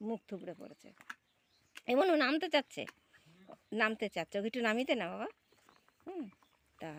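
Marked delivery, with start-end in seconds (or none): 1.9-5.3 loud high call across the yard. Real, not acted. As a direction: call out to your son to help. Calling out to son about duck. (ছেলেকে জোরে ডাকা)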